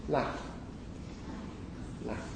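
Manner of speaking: "laugh" with a short northern English a. Shown as a demonstration. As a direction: say "laugh" softly with the short a of "cat". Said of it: "If you are a northerner".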